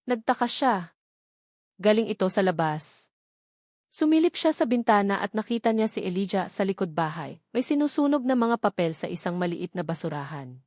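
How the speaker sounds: plain reading voice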